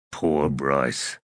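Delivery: low, falling sympathetic comment; dry pity. A narrator's reading. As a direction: drop the pitch in understated pity. Bug pities the brother.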